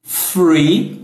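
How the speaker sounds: "free" said with an f, no th; the other mistake; f instead of th; wrong th as f. Shown as a demonstration. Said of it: "falou free com F".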